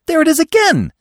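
very high, loud, eager exclamation; excited cry. A narrator's reading. Bambi hearing the call again, high, loud and excited. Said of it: "Bambi cried excitedly".

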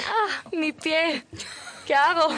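high, slow mock wail over her foot; near tears. Retelling the desert fish accident, mock tearful wail. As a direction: replay the panic, hammed-up and whiny. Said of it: "yo estaba casi llorando".

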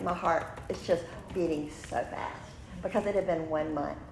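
ordinary talk

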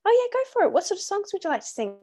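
high, bright, eager welcome; eager homeowner. Retold or imitated. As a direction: gush like a delighted neighbour. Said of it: "A lot of people are like".

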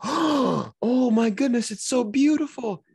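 put-on imagined girlfriend swooning over a carving; mock gushing. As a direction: gush over it like it's a masterpiece.

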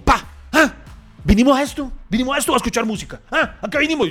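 a hammed-up angry concertgoer griping; imitating angry man. Angry man next to him at the concert, mock angry complaint. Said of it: "me hace así como todo bravo".

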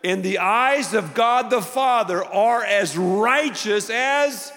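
impassioned preaching. Real, not acted. Preacher assures the redeemed they are righteous, impassioned emphatic preaching. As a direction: build phrase on phrase, holding the key words.

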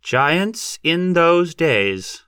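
very slow, high-pitched pulpit proclamation; preacher intoning scripture, drawn out; pontifical. A narrator's reading. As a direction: stretch each word out pompously. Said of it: "he said pontifically".

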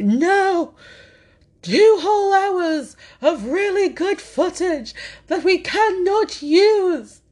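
high, slowed, hammed-up despairing wail; mock meltdown wail. Podcaster mocking her own tech meltdown, hammed-up high wail. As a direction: wail it like a melodramatic tragedy queen. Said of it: "I didn't say it in that voice".

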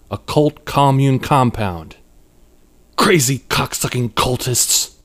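slow, seething inner voice; angry thought. A narrator's reading. Angie fuming to herself at the cult compound, angry. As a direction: think it with contained anger. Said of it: "she thought with anger".

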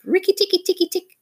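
high, rapid-fire battle chatter; war cry. A narrator's reading. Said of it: "his long war cry of".

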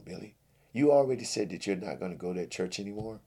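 normal talking voice

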